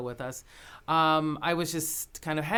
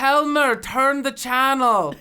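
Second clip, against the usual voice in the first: put-on louder, higher, slow Danish-accented order; insistent Danish call; 7 semitones higher, 7 dB louder, slower than usual. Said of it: "very thick Danish accent".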